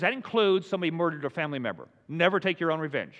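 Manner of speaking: normal talking voice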